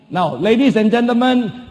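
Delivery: ordinary delivery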